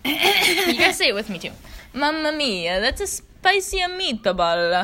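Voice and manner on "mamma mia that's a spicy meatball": hammed-up Italian accent, slow and louder. Shown as a demonstration. Teaching listeners the phrase, mock Italian accent. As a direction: drag out spicy meatball like a stage Italian. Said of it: "my Italian accent".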